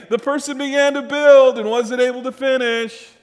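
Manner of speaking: high, loud jeering voice; mocking ridicule. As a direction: sneer it out like a mocking onlooker. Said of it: "will ridicule you, saying".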